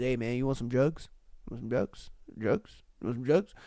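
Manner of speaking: low, hushed offer, very soft; furtive drug pusher. Retold or imitated. Man at the shop counter offering drugs, furtive and hushed. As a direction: drop the voice like a shady dealer.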